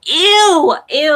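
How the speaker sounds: loud, high disgusted squeal. Real, not acted. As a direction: recoil in disgust. Disgusted by toppings on Visa.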